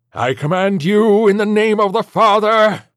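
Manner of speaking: high, slow, full-force exorcism; drawn-out shouted invocation; thundering command. Acted. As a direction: command the demon with all authority. Priest's final command.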